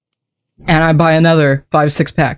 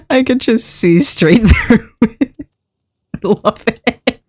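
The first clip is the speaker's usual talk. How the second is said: laughing; 6 semitones higher, slower than usual. Real, not acted. Delighted at see-through head.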